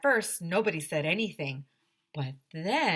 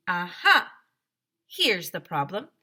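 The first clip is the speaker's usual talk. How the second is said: triumphant aha; 7 dB louder than usual. Acted. Nurse discovers wrapper.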